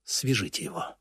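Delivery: cold command. A narrator's reading. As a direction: drop the volume and order it coldly. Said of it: "холодно приказал Лебрак". (холодный приказ)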